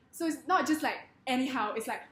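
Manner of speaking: ordinary talk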